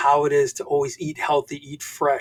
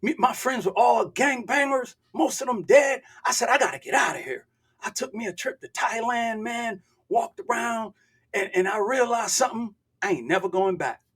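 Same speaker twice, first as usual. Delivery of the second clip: imitated Memphis street accent, pitched higher; 6 semitones higher than usual. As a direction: do a young man's Memphis accent. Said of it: "I gotta do the accent".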